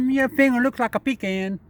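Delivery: high, playful squirrel voice; squirrel's voice. Acted. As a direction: pitch it up as the squirrel talking back. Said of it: "He said".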